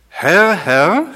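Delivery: loud, high, ringing call of Herr, Herr; God's proclamation. Acted. Preacher voices God announcing his name loudly. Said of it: "Da sagt er".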